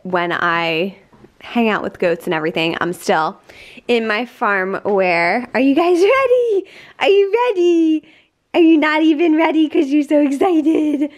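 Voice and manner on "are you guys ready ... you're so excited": high, loud, gushing voice for the dogs; bright pet-hype voice, rising with laughter; excited dog talk. Real, not acted. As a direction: hype the dogs up before the walk.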